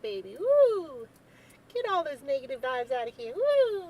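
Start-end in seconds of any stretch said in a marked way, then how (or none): 0.4-1.1 excited whoop. Real, not acted. Sageing the room. 3.3-3.8 high, loud single woo; excited whoop. Real, not acted. Sageing the room.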